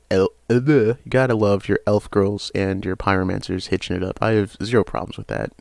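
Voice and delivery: normal talking voice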